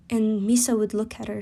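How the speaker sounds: ordinary delivery, nothing marked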